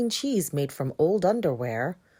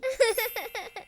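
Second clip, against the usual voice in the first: gleeful laugh. Acted.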